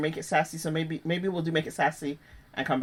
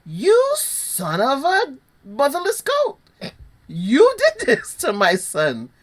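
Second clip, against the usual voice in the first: slow, high, loud enraged yell; furious outburst; 10 semitones higher, 6 dB louder, slower than usual. Retold or imitated. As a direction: hurl the insult at him, dragging each word. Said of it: "And Britt's like".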